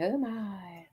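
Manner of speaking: breathy whispered oh my; mock-scandalised whisper; whispered aside. Real, not acted. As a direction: drop to a hushed aside. Aside after mocking rival.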